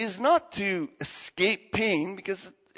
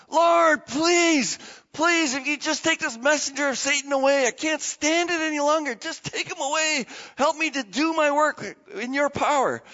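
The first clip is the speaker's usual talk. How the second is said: desperate pleading; 8 semitones higher than usual. Retold or imitated. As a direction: cry out as if you can't stand it. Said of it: "And then another time".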